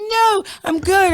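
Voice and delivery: high, whiny insistence; mock pleading denial. Drunk daughter assuring mom, high whiny denial. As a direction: insist in a high teen whine.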